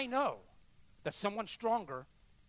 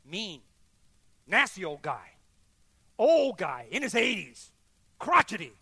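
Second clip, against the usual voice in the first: emphatic; 11 dB louder than usual. Real, not acted. Describing mean neighbour emphatically.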